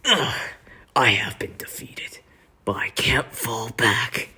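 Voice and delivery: slow, whispery wounded villain; groan, then breathe out the defeat; drawn-out, half-whispered dying villain; Hubert impression. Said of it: "he says".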